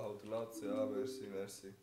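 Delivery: ordinary talk, nothing marked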